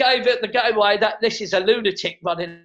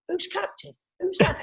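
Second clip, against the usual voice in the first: puzzled fans; 9 semitones higher than usual. Retold or imitated. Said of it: "fans are there going".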